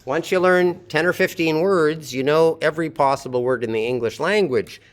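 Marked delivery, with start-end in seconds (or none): none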